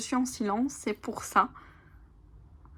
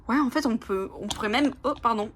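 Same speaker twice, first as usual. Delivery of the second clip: ordinary talk, nothing marked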